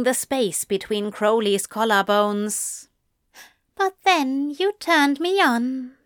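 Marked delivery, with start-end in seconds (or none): 3.8-6.0 high, teasing angel delivering a pick-up line; playful, pitched-up flirting. A narrator's reading. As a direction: land the cheesy line in a raised voice. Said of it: "Aziraphale murmured".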